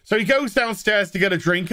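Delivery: ordinary delivery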